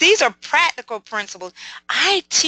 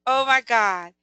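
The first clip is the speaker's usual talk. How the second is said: ordinary talk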